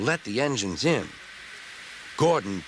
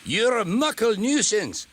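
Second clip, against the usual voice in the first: high, loud Scottish scold; angry twin engine in a Scots accent; 11 semitones higher, 3 dB louder than usual. Acted. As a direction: tell him off in a broad Scots voice.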